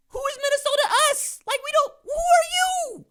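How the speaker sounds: high falsetto mock disbelief; mock incredulous. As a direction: squeak it like you can't believe it. Mocking Minnesota's importance.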